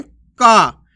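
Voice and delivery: का with a long, loud "aa"; drawn-out vowel. Shown as a demonstration. Teacher showing learners how the matra lengthens का, drawn out. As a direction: stretch the vowel of का. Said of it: "अक्षर का उच्चारण अपन खींच के करते हैं".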